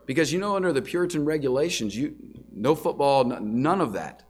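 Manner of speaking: normal talking voice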